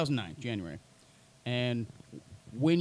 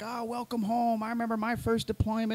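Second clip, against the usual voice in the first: sappy veterans; 8 semitones higher than usual. Retold or imitated. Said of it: "everyone's like".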